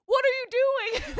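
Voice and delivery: high, loud and slow through laughter; laughing mock shock. Podcaster laughs quoting the shocked kisser, high and loud. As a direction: squeal it while laughing. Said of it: "then being like".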